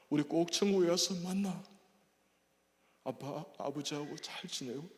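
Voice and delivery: tender plea to mother. Retold or imitated. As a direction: talk to a dying mother tenderly. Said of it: "어머니 밥을 이렇게". (임종을 앞둔 어머니께 애틋하게 간청하며)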